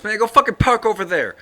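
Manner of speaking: imitating an irate driver, high and loud; retold road-rage shouting; angry driver's yell. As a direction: shout the order across the street. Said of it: "Like that, right?".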